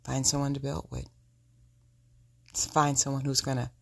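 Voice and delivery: ordinary delivery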